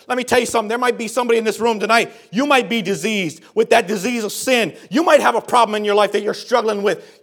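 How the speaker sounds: ordinary talk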